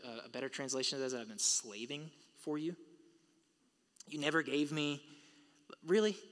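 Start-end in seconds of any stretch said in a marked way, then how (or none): 4.1-5.0 mock the ungrateful son; mocking whine. Preacher mocking the older son's grumbling, sarcastic. 5.8-6.2 a high, loud, disbelieving really; incredulous. Real, not acted. Preacher reacting to the son's claim, incredulous.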